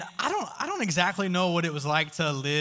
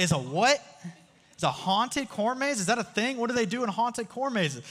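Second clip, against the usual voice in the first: high, rising alarm at the news; hearing that it's haunted, startled disbelief; shocked disbelief; 6 semitones higher than usual. Retold or imitated. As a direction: pitch up in panicked surprise.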